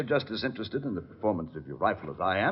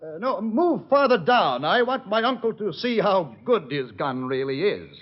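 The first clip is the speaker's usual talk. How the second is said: calling out; 8 semitones higher, 4 dB louder than usual. Acted. Shouting to henchman far off.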